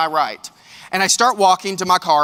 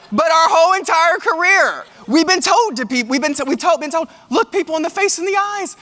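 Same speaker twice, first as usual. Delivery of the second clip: much higher, worked-up voice; exasperated protest; 10 semitones higher, 4 dB louder than usual. Acted. Speaker protesting what a whole career taught, exasperated. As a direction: protest loudly, pitched way up. Mock outrage at taught rule.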